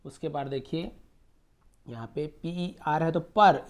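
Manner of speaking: normal talking voice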